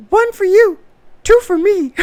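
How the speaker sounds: put-on very high, squeaky toddler voice; sing-song it like a cheeky little boy; tiny boy bragging about girls; little kid voice. Son's toddler quote.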